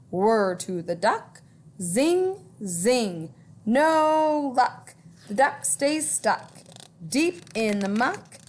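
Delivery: put-on lilting read-aloud for children; storytime reading. A narrator's reading. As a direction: read it like a picture book to kids. Reading rhyming book aloud.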